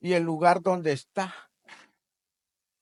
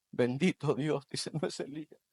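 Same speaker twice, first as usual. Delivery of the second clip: hushed reverent aside; soft murmur; 4 semitones lower, 8 dB quieter than usual. Acted. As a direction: say it almost to yourself. Soft aside in the acted dialogue. (murmullo reverente)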